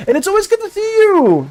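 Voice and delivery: high, bright exclamation; excited. Real, not acted. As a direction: call it out with delight. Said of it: "Genuinely, it's always good to see you".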